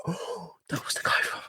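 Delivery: hushed, choked-up whisper; teary whisper. Retold or imitated. Retelling welling up after meeting idol, choked whisper. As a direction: breathe it out overcome with emotion.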